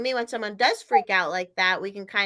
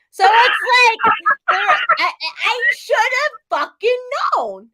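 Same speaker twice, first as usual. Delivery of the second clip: exasperated realization; 11 semitones higher, 7 dB louder than usual. Real, not acted. Realizing her period caused it.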